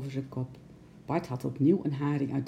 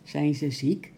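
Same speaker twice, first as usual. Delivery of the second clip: ordinary delivery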